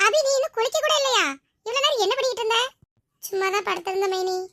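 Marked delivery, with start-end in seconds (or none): 0.0-2.6 high, louder chiding voice; chiding sister-in-law. Acted. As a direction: scold her for dawdling, pitched high. Sister-in-law chides her for dawdling. (கண்டிக்கும் மைனியின் உயர்ந்த குரல்)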